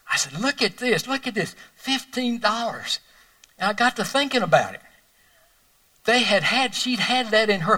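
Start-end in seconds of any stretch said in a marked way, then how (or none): 0.4-3.0 amazed. Retold or imitated. Finding fifteen dollars in card.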